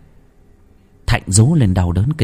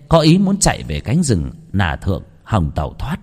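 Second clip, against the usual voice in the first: ordinary delivery, nothing marked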